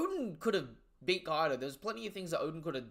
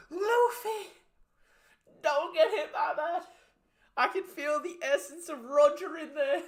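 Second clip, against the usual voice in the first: high-pitched, hammed-up warning shout; mock panicked scream; 13 semitones higher, 7 dB louder than usual. Zoro warning Luffy to dodge, mock screaming. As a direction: yell it out loud, pitched way up. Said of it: "Zoro's screaming Luffy dodge".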